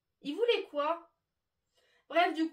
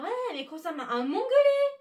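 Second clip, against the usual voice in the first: mocking rude man; 6 semitones higher, 9 dB louder than usual. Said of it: "un monsieur qui ose me dire".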